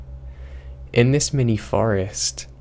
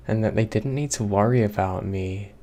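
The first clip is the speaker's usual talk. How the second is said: plain reading voice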